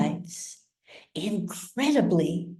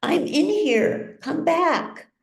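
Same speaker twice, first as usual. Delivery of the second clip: much higher, pleading call; desperate calling out; 11 semitones higher than usual. A narrator's reading. Trapped patient calls after doctors, high and pleading. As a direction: call them back desperately. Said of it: "he screams".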